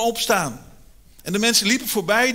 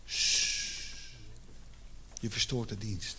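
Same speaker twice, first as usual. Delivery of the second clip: hushing; 8 semitones lower, 13 dB quieter, slower than usual. Retold or imitated. Followers hush the blind man.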